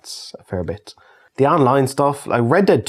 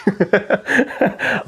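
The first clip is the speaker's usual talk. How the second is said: delighted laugh; 10 semitones higher than usual. Real, not acted. After calling the match epic.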